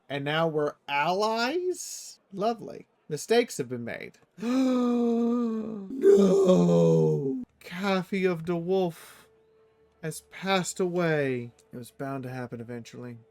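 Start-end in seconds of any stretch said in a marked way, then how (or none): 5.9-7.4 loud gasp then anguished "No!"; shocked gasp. Real, not acted. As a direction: gasp and cry out at the loss. Character dies.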